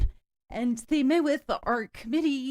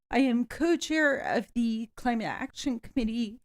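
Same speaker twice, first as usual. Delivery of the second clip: ordinary talk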